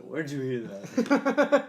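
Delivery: loud, drawn-out mock demand; exaggerated accusing question; mock interrogation. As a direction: act out a suspicious question, laughing. Acting a stern reaction, laughing.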